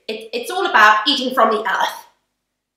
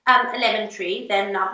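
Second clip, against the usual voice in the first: usual speaking voice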